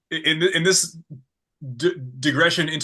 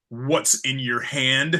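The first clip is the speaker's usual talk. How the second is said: slow, level deadpan question; much slower than usual. Retold or imitated. Zach to kid holding the figure he wanted, deadpan. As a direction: imitate a friend asking slowly, without any excitement. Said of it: "Zach was so deadpan".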